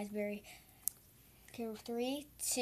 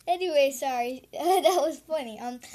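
normal talking voice

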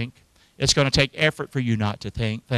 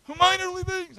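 much higher, strained, exasperated reread; high, frazzled mock reading; worn-out reading. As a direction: read it as if the verse wears you out. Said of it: "I got wore out with that verse".

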